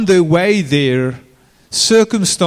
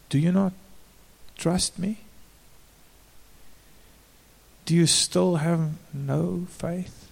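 softer, calm questioning; gentle Jesus; 8 dB quieter than usual. Retold or imitated. Jesus gently asking his frightened disciples, softly. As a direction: ask it gently, almost hushed.

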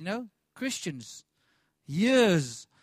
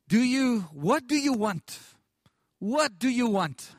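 loud, slow, higher call to the crowd; preacher's loud call; 5 semitones higher, 7 dB louder, slower than usual. Retold or imitated. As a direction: call out the question to the whole barn, slowly. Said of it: "Angus just asked this question".

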